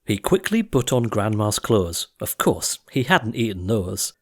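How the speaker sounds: plain reading voice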